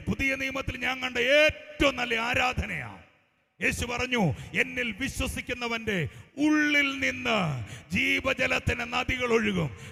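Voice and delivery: high, loud, relentless preacher's delivery; full-force prophetic preaching. Real, not acted. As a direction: proclaim it over the congregation, building to a shout. (അത്യാവേശത്തോടെ ഉച്ചത്തിൽ പ്രവചന പ്രസംഗം)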